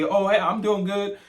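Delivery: high, loud startled greeting; a passerby caught off guard, answering; startled reply. Retold or imitated. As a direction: jump and blurt out a hello. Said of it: "they jump like this and say".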